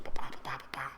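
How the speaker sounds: breathy whispered bang noises; hushed imitated gunfire; whispered gunshots. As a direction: whisper the shots out. Imitating the shots of a kill.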